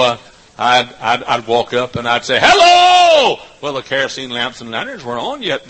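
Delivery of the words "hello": loud, much higher shouted hello; calling out. Retold or imitated. Preacher greeting the crowd in the dark, shouting.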